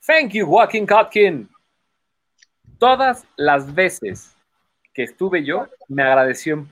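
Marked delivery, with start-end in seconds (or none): 0.1-1.5 high, drawn-out stage thanks; David Copperfield. Retold or imitated. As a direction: imitate a star magician calling to one spectator. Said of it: "volteaba y decía". (agradecimiento agudo y teatral desde el escenario)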